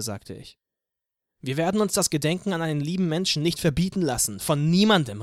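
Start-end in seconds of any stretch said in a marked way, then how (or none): 1.4-5.2 loud, defiant call. Acted. Anna calls out to the crowd, loud and defiant. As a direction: call it out, refusing to be silenced. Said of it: "rief Anna".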